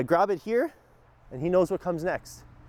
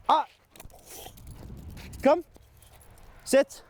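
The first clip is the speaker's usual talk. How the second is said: upset high command; 12 semitones higher, slower than usual. Real, not acted. Trainer correcting his excited dog, high firm command. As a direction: snap the dog into a sit. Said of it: "get upset and do some high tones".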